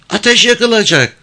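put-on high-pitched girl's voice; high little reply; village girl. A narrator's reading. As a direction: answer him as a young village girl, pitched well up. Girl questioned by the man.

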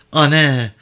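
loud, high cry for mother; imagined daughter calling out loudly; child's shouted call. A narrator's reading. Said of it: "diye bağırdığını duyar gibi".

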